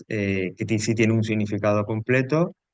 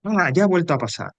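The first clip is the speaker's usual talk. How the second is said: higher-pitched, mildly irritated exclamations; mock annoyance; 7 semitones higher than usual. Shown as a demonstration. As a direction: act a touch fed up, not truly angry. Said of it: "O enfado".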